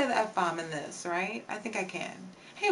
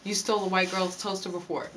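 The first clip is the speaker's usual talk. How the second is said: ordinary talk, nothing marked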